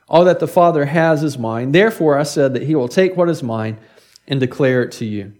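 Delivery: measured scripture reading from the pulpit. Acted. Preacher reading John to the congregation, measured and solemn. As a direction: read the passage with weight.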